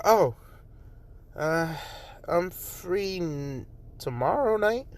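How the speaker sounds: high, halting, rising to a question; flustered, hopeful stammer; shy hesitant offer. Acted. Shy character accepts invitation.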